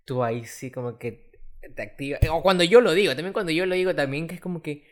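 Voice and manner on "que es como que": ordinary talk, nothing marked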